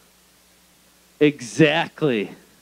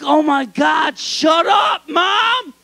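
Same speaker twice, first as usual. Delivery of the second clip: put-on higher, louder teen shouting back; embarrassed yell; 10 semitones higher, 4 dB louder, much slower than usual. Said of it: "I'm just like".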